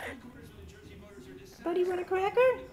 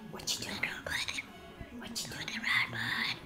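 whispering; 3 semitones lower, 9 dB quieter, much faster than usual. Real, not acted. Whispering to pet bird.

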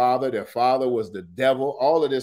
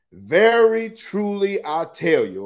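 high, loud, drawn-out pronouncement; emphatic declaration; 6 semitones higher, 5 dB louder than usual. Acted. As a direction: proclaim it slowly and forcefully. Reading Jesus's solemn declaration aloud.

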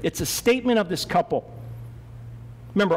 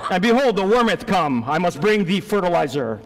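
loud, laughing, hammed-up old English; mock biblical voice; 4 dB louder than usual. Teasing Eli about his yard work, mock biblical boom. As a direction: boom it out like a Bible actor, cracking up. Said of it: "You do, Eli".

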